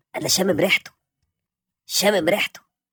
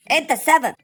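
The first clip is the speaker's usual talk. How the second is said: shouting the accusation, rising; angry blame; 8 semitones higher, 14 dB louder than usual. Acted. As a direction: yell the blame at him. Blaming the dog loudly.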